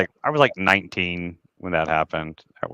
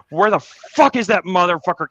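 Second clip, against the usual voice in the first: higher-pitched reenacted angry demand; retold blow-up; 8 semitones higher, 4 dB louder than usual. Man retells phoning Billy after being ripped off, retold blow-up. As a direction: act out blowing up at a friend. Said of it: "I never normally would have blown up".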